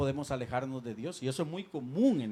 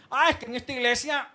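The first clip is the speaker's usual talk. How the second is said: imitated complaint; 8 semitones higher, 8 dB louder than usual. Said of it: "por eso decimos".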